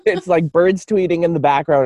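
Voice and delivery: ordinary delivery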